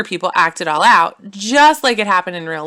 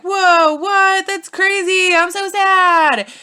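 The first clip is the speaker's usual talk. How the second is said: much higher and louder, hammed-up shock; shocked sad Hamlet; 12 semitones higher, 5 dB louder, much slower than usual. Hamlet to his ghost dad, hammed-up shock and mock sorrow. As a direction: squeak it out, then draw out the mock sadness slowly.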